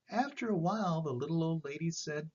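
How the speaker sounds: ordinary delivery, nothing marked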